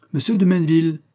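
high, loud commanding call; authoritative cry. Acted. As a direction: call him to order with authority. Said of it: "s'écria-t-il avec autorité".

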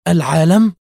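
high, loud rising question; stunned. A narrator's reading. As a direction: exclaim in disbelief. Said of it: "هتفت مبهوتة".

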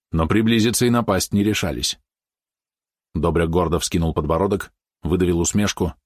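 usual speaking voice

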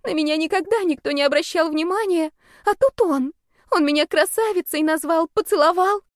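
put-on high, plaintive girlish outpouring; tearful gushing. A narrator's reading. Sobbing girl explains why she loves him, high plaintive gush. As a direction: pour it out, pitched high and upset. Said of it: "давясь рыданиями, затараторила она".

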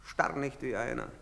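ordinary talk, nothing marked

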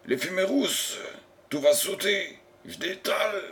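high-pitched, urgent alarm; alarmed warning. Acted. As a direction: warn them as you bolt, pitch way up. Fleeing engineer warns of explosion. (alerte affolée, voix aiguë)

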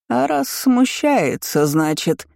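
high, slow insinuation; taunting drawl. A narrator's reading. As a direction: draw it out to needle her. Aunt keeps taunting in drawl. (ехидно, нараспев)

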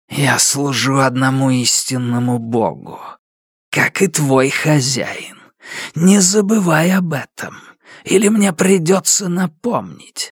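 high, menacing shadow voice; creeping nightmare whisper. Acted. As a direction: warn him like a dark spirit. Shadow warns Fardo menacingly.

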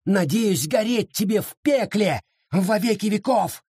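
high, slow, frenzied cursing; raging curse. Acted. Half-mad Sara cursing Abita Williams, high slow rage. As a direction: spit every curse out slowly and high.

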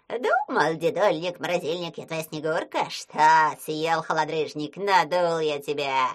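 loud, slow taunting creature voice; gloating yell. Acted. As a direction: crow over the trick, loud. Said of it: "вопил он".

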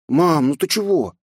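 put-on high, slow boy voice; bewildered small boy; puzzled child. A narrator's reading. Boy puzzled by crying mother. (недоумевающий мальчик)

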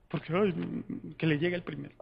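low, soft mock-timid aside; cobarde escondido. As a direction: murmur it like someone hiding at the back. Voices the cowards hiding behind. (murmullo bajo de cobarde fingido)

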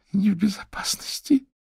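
high unvoiced whisper of a scared little girl; frightened little girl whisper. A narrator's reading. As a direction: whisper it like a terrified small child. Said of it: "неуверенный голосок". (испуганный шёпот маленькой девочки)

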